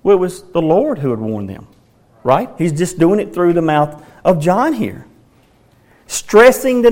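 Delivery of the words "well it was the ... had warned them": ordinary delivery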